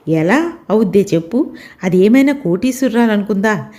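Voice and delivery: usual speaking voice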